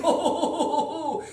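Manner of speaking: high, loud hammed-up chortle; gleeful dragon laugh. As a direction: cackle over the gold.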